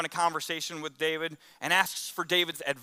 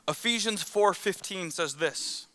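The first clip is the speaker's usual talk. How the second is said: ordinary delivery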